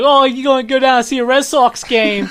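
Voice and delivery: high, loud, hammed-up Boston accent. Shown as a demonstration. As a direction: play a Red Sox fan in a thick Boston accent. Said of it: "you can at least do a Boston accent".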